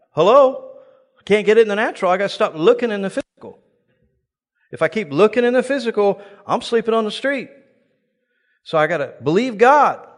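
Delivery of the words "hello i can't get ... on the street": fervent preaching. Acted. Preacher urging the crowd to stop looking physical, fervent. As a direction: plead your case with rising fire. Preaching at full force, exclaiming.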